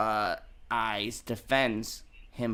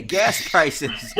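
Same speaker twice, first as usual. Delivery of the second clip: laughing; 6 dB louder than usual. Real, not acted. Reading news while cracking up.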